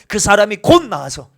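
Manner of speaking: "곧" hit loud and falling; stress on 곧. Shown as a demonstration. Pastor stressing "곧" to show the original text. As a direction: punch the word "곧". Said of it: "진짜 원어에 보니까 곧이 있어요".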